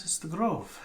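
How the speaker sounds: high, loud in-character woman. Acted. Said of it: "She goes".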